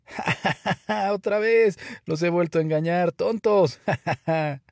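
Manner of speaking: high, taunting laughter; roaring with laughter. A narrator's reading. Shepherd boy mocking the villagers he tricked, laughing hard. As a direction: crow with glee between guffaws. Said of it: "riéndose a carcajadas".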